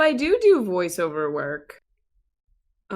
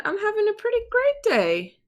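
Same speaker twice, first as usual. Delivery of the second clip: high silly voice. Acted. High put-on voice.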